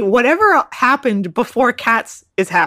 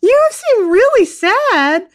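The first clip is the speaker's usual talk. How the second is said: baffled concern; 13 semitones higher, 4 dB louder than usual. Retold or imitated. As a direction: question it high, puzzled and concerned. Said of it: "And I'm like".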